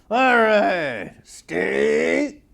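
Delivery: loud, very slow stretched goodbye; groovy sign-off. Acted. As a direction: drawl out the catchphrase. Show sign-off.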